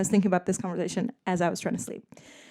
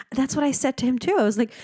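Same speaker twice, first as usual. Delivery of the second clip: usual speaking voice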